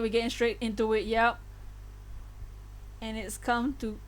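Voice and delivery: ordinary talk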